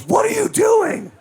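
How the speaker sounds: very high, slow, horrified scream; loud, drawn-out high-pitched yell; yelling mom. Retold or imitated. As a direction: imitate a scary mother shrieking at her kid. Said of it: "My mom yelled at me louder".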